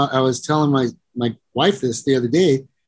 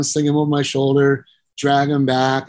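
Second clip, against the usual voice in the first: ordinary talk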